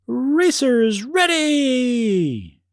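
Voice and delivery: long, drawn-out high call; yelling. Acted. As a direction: call it out to the whole stadium. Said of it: "she yelled".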